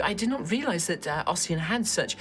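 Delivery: high-pitched, effusive lady scholar; gushing dragonborn woman. Acted. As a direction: gush with affected fascination. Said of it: "you just hear a sort of like".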